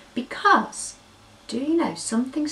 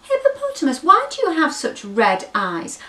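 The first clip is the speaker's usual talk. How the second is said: high, loud, timid little calf; nervous calf asking a big hippo; high hesitant calf's question; curious baby elephant; 9 semitones higher, 6 dB louder than usual. Acted.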